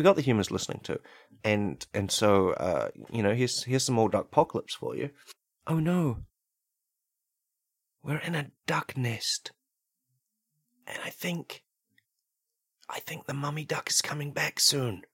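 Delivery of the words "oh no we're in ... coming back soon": high, hushed, halting dread; frightened human in skit. Acted. Human warns that the mummy duck is coming, hushed and afraid. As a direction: act out nervous suspense, pitched up with long pauses.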